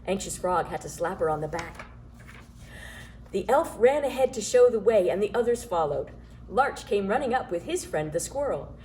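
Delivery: plain reading voice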